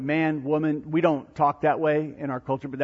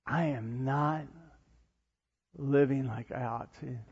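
quiet confession; 2 semitones lower, 7 dB quieter than usual. Acted. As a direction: admit it softly to yourself, with pauses. Voicing a guilty inner confession.

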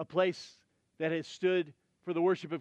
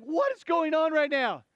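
higher, louder, rattled and confused; flustered servant; 7 semitones higher, 4 dB louder than usual. Acted. Servant Gehazi panicking at the woman at the prophet's feet. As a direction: sputter it as a bewildered servant. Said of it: "He's like".

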